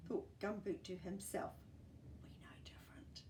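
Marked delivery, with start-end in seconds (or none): 2.2-3.2 breathy unvoiced whisper aside. Narrator whispering an aside to the children. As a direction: lean in and whisper the secret.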